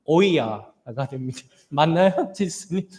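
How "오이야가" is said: 오이야 drawn out slowly with a rising Gyeongsang lilt, low; a pastor imitating a Gyeongsang grandma saying 오이야, slow; Gyeongsang dialect. Shown as a demonstration. 경상도 사투리로 느리게 "오이야". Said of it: "비슷하게 해보자면 경상도 말로".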